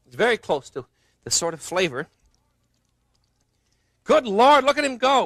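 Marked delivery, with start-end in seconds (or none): 4.0-5.2 surprised exclamation. Real, not acted.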